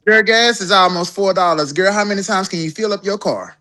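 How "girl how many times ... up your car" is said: ordinary talk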